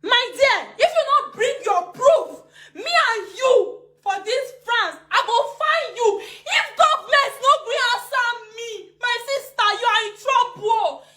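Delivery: high, loud threat through laughter; laughing threat. Real, not acted. Threatening the accuser, laughing and near tears. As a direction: warn her with a tearful laugh.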